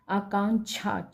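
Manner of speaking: आकांक्षा as aakaanchha, ending छा; wrong consonant. Shown as a demonstration. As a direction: swap क्षा for छा in आकांक्षा. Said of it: "छा लिखा है". (आकांक्षा का अंत 'छा' से)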